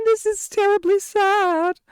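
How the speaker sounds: high, slow mock-tragic whine; hammed-up lament, slow and high; mock sad. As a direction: overplay the sadness as a joke.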